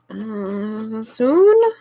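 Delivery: sheepishly unsure. Retold or imitated. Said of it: "I'm like".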